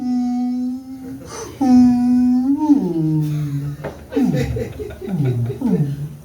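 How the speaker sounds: long drawn-out high whale call; whale noise. Shown as a demonstration. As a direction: moan and click like a whale. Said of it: "can't do is whale".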